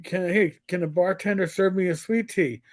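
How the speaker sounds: normal talking voice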